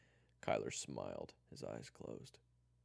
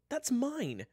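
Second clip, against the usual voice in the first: very high, loud objection; loud protest; 13 dB louder than usual. Acted. June claims her water bottle back, high loud protest. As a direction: cry out indignantly. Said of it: "she protested".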